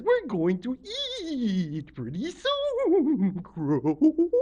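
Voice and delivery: slow, high croon with very long vowels; Octopus Lady. Acted. Octopus Lady promising hungry Crow food, slow swooping croon. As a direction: stretch it out, loud and swooping. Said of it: "And Octopus Lady says".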